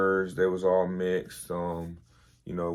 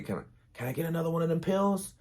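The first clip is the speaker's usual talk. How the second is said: higher, fast lisping plea; 9 semitones higher, 4 dB quieter, much faster than usual. Retold or imitated. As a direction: beg for another pill, lisping. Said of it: "but he had a lisp".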